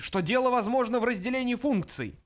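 high, insistent outburst; roaring demand. A narrator's reading. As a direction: keep roaring the protest. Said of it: "взревел Врумфондель".

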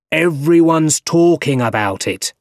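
excited cry. A narrator's reading. Editor to his staff, crying out. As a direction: call it out to the whole office. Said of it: "cried Jonah Jameson in his office".

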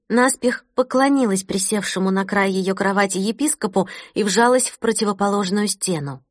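plain reading voice

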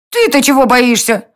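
high indignant fairy voice; flaring-up fairy. A narrator's reading. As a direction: flare up, pitched high. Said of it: "взвилась старшая дворцовая фея".

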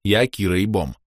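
ordinary delivery

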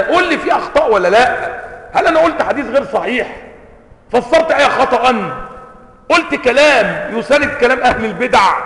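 high, loud indignant questioning; exasperated protest. Acted. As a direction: fire indignant questions at the critic. Acted protest against pronunciation critics.

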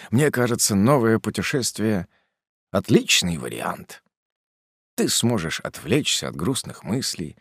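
ordinary delivery, nothing marked

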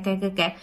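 soft, low creak of a dry hinge; creaking door. Shown as a demonstration. Narrator imitating the door creak again, soft and low. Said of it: "nó kêu cái thế hệ là tiếng kè".

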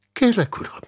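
high, pleading request; urgent, high plea; earnest appeal. Acted. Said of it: "próbálta lelkére beszélni a másik".

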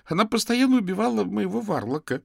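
put-on very high, loud, upset child; wronged boy's high, tearful outburst; tearful exclamation. A narrator's reading. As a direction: cry out the grievance, near tears. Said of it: "со слезами на глазах воскликнул Тим".

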